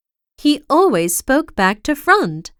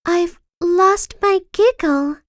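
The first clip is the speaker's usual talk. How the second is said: miserable; 7 semitones higher than usual. A narrator's reading. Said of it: "she explained miserably".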